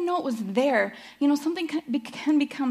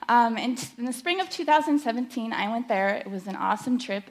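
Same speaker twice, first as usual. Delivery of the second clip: ordinary talk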